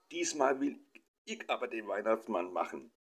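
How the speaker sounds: put-on child's high cry cutting in, high on „Diesmal“; eager boy yelling his claim; shouting in. A narrator's reading. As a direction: blurt it out over the parents. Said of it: "schrie der Sohn dazwischen".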